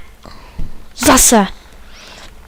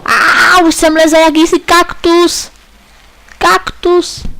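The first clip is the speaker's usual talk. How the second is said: startled outcry; 7 semitones higher, slower than usual. Real, not acted. Crashes into a cactus.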